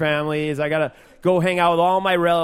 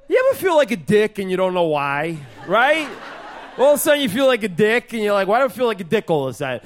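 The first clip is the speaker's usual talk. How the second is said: cousin Joey; 4 semitones higher than usual. Acted. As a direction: do your cousin as an eager amateur comic. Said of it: "this would be my cousin Joey doing stand-up comedy".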